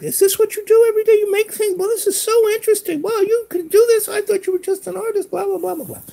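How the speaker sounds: very high, squeaky mock mom voice; high-pitched mimicry of an amazed mother; friend's excited mom. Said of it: "and she's like".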